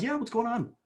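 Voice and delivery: mock dad answering his son; imitating the dad. Voicing the father answering the son.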